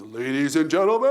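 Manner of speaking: big announcer baritone, last word held out; Mark Stelzner. Retold or imitated. Speaker with a radio voice opening the show, grand announcer baritone. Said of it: "gorgeous baritone voice".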